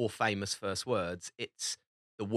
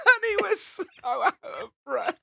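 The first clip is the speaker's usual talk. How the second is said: high, choked with laughter; cracking up mid-sentence; helpless laughing; 5 dB louder than usual. Real, not acted. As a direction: squeeze the words out through laughter. Cracking up at own story.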